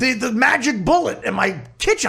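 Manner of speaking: whiny plea, pitch jumping up; mock pleading. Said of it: "I'm like".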